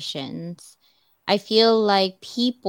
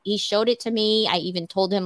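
normal talking voice

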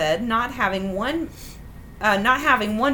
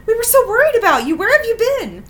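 high, loud, hurried parental scolding; furious squawk; 11 semitones higher, 7 dB louder than usual. A narrator's reading. Parents confronting their missing daughter at night, squawking furiously. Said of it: "they squawked furiously".

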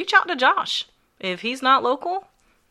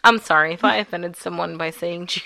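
ordinary delivery

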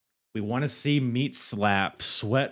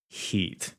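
ordinary talk

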